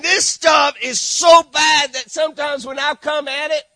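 loud, high preaching shout, slowing on the big lines; fiery preaching. Acted. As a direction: hammer home how bad the trash is. Preaching about Haiti trash.

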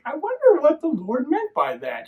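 high musing voice of a pondering woman. Retold or imitated. Woman pondering the Lord's meaning, high and musing. Character's inner thought voiced.

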